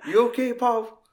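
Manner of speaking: high, caring check-in; Bob checking on him. Retold or imitated. Bob leaning in to the shivering man, high and kind. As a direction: ask it up high, kindly. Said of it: "He's like".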